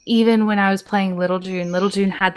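ordinary delivery

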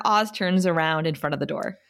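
normal talking voice

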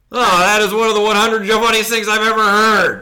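imagined listener gushing over the joke, high and loud; mock delighted guffaw. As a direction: gush like it's the funniest thing ever. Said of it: "do you think he buckled over".

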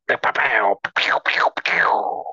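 imitated cartoon gunfire, mostly voiceless; jokey "pow pow" shooting sounds; playful gunshot noises. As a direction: fire off mouth-made pistol shots.

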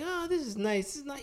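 high, hushed mock admiration; soft admiration. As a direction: imitate Putin softly cooing over a prize. Retold Putin admiring the ring.

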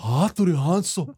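slow hammed-up Japanese accent; fake Japanese accent. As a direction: savour the name in mock Japanese. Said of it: "auf Pseudo-Japanisch wiederholen".

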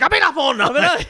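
loud, high-pitched frantic yell; hammed-up outburst of a worn-out actor; mock yelling. Over-methoded tired dad yelling at the casting director. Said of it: "yelling at the casting director".

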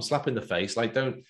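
ordinary talk

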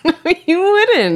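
high, slow laughing no; laughing disbelief. Real, not acted.

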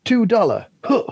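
high-pitched impression with a grunt. Copying a friend's hilarious bandleader impression, high-pitched mimicry. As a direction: imitate a soul bandleader fining a musician, high.

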